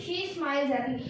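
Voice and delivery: each word read out slowly for the class; slow, careful reading of the example; slow dictation. Shown as a demonstration. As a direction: spell the sentence out word by word. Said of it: "क्या question है".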